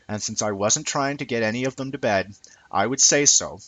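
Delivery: ordinary delivery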